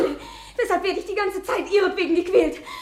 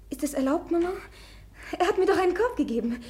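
ordinary talk, nothing marked

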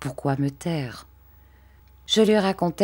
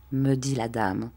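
plain reading voice

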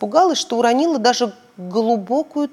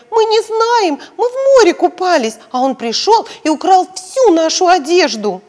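put-on much higher, louder child voice; little girls; 8 semitones higher, 6 dB louder than usual. Girls telling the policeman about the theft, high child voice. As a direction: explain like small girls.

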